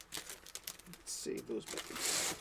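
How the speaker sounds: breathless whisper, slow and soft; whispered aside. Real, not acted. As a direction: murmur it to yourself, slowly. Aside while rebagging comics.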